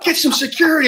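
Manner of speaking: high, loud enraged order; furious yell. Retold or imitated. Gunny yelling at his snipers to get out. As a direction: scream the command at them. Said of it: "I about lost my mind".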